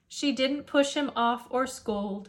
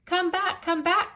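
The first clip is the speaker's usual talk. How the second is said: calling after; 7 semitones higher than usual. Acted. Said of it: "Cook Janie cried".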